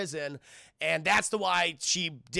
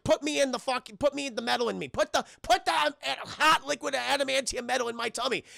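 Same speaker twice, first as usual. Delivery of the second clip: mock pleading; 7 semitones higher than usual. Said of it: "He's like".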